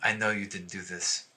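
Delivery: soft, confidential aside; quiet reassurance. Acted. Okoria approaches the accused in private, quietly reassuring.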